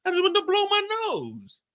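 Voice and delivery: squeaky, high and hurt; mock-offended high voice; high indignant squeak. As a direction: protest in a high wounded voice. His indignant reply to the chat.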